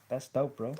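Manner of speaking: ordinary talk, nothing marked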